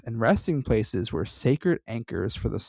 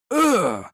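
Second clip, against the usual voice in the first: mummy groan; 10 semitones higher, 6 dB louder than usual. Retold or imitated. Repeating the mummy sound.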